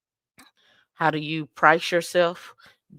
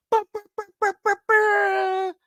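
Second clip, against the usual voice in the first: high burring horn, last one stretched; DJ airhorn noise. Retold or imitated. Host acting out the DJ, mock airhorn burrs. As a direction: imitate a DJ's airhorn by mouth. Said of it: "when the D J be like".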